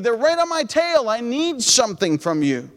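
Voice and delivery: ordinary delivery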